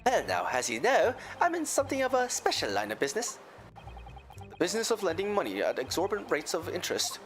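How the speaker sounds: a lilting Irish brogue; Irish accent. Acted. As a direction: tell it like an Irish witness. McGilded in accent.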